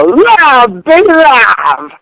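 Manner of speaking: drawn-out, high-pitched radio yell. Real, not acted. Radio host hyping listeners on air, yelling. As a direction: belt it out loud, stretching the words. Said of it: "don't ever be yelling in my ear".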